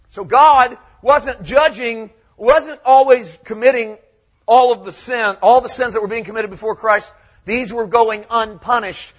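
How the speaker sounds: loud, high-pitched pulpit delivery; full-force preaching. Real, not acted. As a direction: preach it out to the whole church, loud and emphatic.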